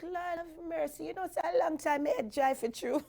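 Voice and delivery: high, nostalgic astonishment; amazed. Retold or imitated. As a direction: marvel at an old photo. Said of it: "And I'm like".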